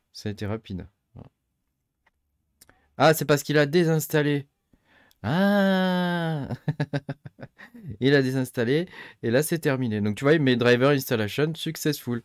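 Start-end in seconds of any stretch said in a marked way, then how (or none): 5.2-6.5 long, high "aah" of sudden understanding; exclamation of realisation. Real, not acted. Miner realising the driver got uninstalled, drawn-out high "ah". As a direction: draw out a high "ah" as it clicks.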